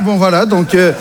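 ordinary delivery

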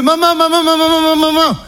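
high-pitched frantic cries; panicked calling. Acted. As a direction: rattle it off high as you rush to the rescue. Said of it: "j'ai couru pour libérer maman". (appels affolés et aigus, joués)

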